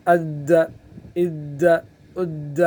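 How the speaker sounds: اد released with a bounce; qalqala drill. Shown as a demonstration. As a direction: echo the د at the end of اد. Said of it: "کوئی قلقلہ ہے".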